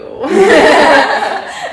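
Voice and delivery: bursting out laughing; big open laugh; loud laugh. Real, not acted. Loud laugh during the story.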